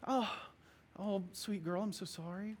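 put-on soft, hushed soothing of a scared child; calm her down gently; comforting parent. Father comforting his frightened daughter, soft and tender.

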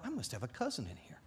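hushed, musing to oneself; very soft retold thought; private inner thought. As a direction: think it aloud under the breath. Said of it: "I thought".

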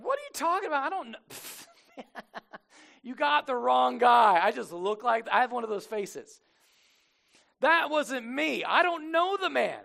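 loud, high-pitched acted protest; comic indignant disciple, high and loud; defensive denial. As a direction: deny it loudly, voice jumping up. Acted Peter denying he knows Jesus.

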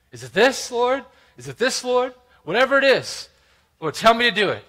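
high, urgent prayer; eager pleading. Acted. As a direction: beg eagerly to be told what to do. Acted eager prayer for direction.

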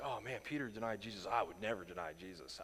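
low, soft self-righteous mock; smug critic. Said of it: "some people are just like".

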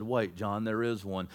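very low, soft and steady; quiet reassurance. Retold or imitated. Elder in the heavenly realm calming the apostle, hushed. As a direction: settle him gently, deep in pitch.

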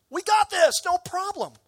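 high, loud, brash mock bravado; cocky disciples. Preacher mocking the disciples' boast, high and loud. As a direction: toss it off like overconfident students.

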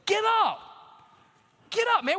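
loud, high shout of get up; preacher's rallying shout; shouted command. Acted. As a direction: yell it like a rallying cry.

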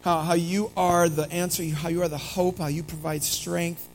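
soft, unhurried prayer voice. Real, not acted. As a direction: pray quietly over the congregation. Pastor leads opening prayer.

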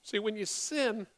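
ordinary talk

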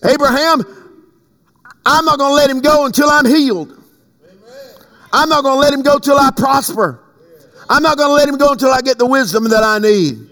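high, insistent preacher's declarations; fervent preaching. Real, not acted. Preacher vowing healing and deliverance for congregation, fervent preaching. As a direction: drive each vow home in a high preaching cry.

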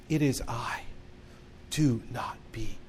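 hushed and tender, fading away on the last word; kind, caring reassurance. Retold or imitated. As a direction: comfort someone frightened, very quietly. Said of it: "any more kind, caring, and loving words".

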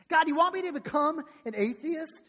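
acted kid at wit's end; exasperated threat. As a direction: press the question on God, voice lifted. Kid repeats threat more forcefully.

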